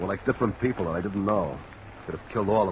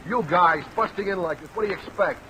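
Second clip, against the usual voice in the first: defensive protest; 14 semitones higher, 4 dB louder than usual. Acted. Suspect protests police raid.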